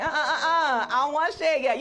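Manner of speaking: high, loud laughing whine; laughing protest. Retold or imitated. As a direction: squeal out a reluctant no, laughing. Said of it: "And I was like".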